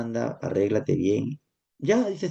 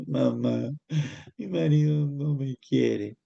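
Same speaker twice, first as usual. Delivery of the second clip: slow, whiny grown daughter voice; hija llorona; slower than usual. Acted. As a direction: plead like a child crying to mom. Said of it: "se va donde su mamá a llorar". (voz lenta de hija quejumbrosa que llora con su mamá)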